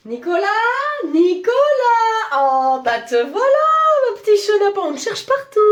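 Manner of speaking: high, sing-song mock-sweet call; singsong calling out. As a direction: hunt him down with a high lilting call. Boss calls out for missing employee.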